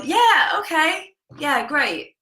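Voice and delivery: high-pitched, politely offhand reply; noncommittal agreement. Retold or imitated. Lash girl replying to her client's invitation, noncommittal. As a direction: imitate an airy, noncommittal yes. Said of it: "in that manner".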